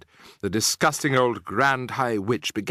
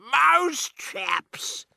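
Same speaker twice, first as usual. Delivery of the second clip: crying out. Acted. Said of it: "cried the witches".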